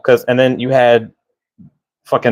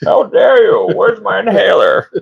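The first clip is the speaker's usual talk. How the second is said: hammed-up villain indignation, higher; overplayed huffy Bane; mock Bane outrage; 9 semitones higher than usual. S0 doing Bane impression.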